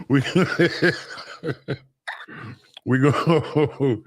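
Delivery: cracking up mid-sentence; real laughter breaking the words; stumbling through giggles; laughing. Real, not acted. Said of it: "'cause you made me chuckle".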